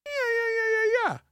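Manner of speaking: very high soft squeak; squeaky mock yeah. Mocking squeal.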